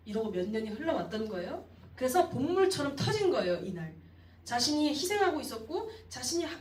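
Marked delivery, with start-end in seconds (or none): none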